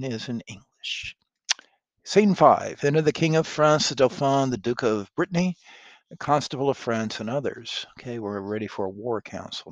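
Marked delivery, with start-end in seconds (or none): 2.0-7.9 announcer. A narrator's reading. Reads the stage direction.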